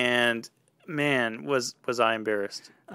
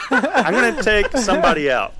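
laughing, louder and higher; hammed-up charge out of the smoke; playful warrior yell; mock battle cry; 7 semitones higher, 6 dB louder than usual. Reenacting charging with golf club.

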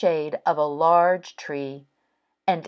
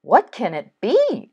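higher-pitched, wondering question; puzzled Frog; 6 semitones higher than usual. Acted. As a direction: ask it in mild bewilderment as Frog.

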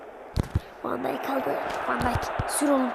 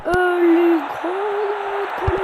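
excited call; 5 semitones higher, 7 dB louder, much slower than usual. Real, not acted. Game chance, drawn-out excited call.

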